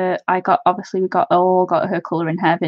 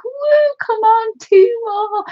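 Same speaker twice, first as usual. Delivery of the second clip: high-pitched excited cheer; eager cheering; 13 semitones higher, 4 dB louder, slower than usual. Retold or imitated. Streamer re-enacting her wait for more followers, eager cheering. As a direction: egg them on with a whoop. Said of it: "I've been like".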